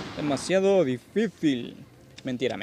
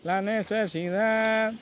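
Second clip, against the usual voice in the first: slow, grand movie-trailer voice; epic announcer voice; 4 semitones higher, much slower than usual. Acted. Dramatic slow hype speech.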